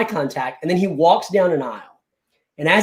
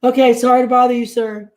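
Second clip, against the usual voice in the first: raised, quick, put-on politeness; a touch of exaggerated deference; mock apology; 6 semitones higher, 4 dB louder than usual. Customer retreating from a rude clerk, mock-apologetic.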